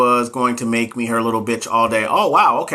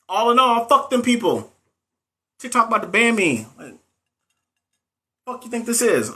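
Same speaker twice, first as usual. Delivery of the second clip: fired-up rant; 8 semitones higher than usual. Real, not acted. Fired-up rant at rude nurses.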